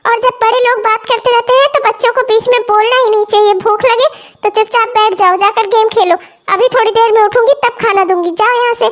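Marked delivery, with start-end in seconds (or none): none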